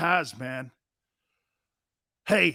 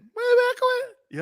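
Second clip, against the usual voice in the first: slow, very high falsetto; drawn-out squeaky cartoon voice; Mickey Mouse squeak; slower than usual. Shown as a demonstration. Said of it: "I'm like a Mickey Mouse".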